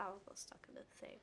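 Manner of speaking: whispered aside. Real, not acted. Disappointed aside while playing.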